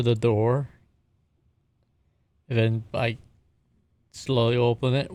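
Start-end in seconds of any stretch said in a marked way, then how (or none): none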